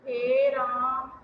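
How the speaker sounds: हे राम stretched very slowly, the vowel of राम held long; drawn-out pluta vowel. Shown as a demonstration. Teacher demonstrating pluta vowel to students, हे राम held long. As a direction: draw राम out to triple length. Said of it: "ऐसे बोलते हैं".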